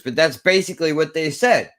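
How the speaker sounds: ordinary talk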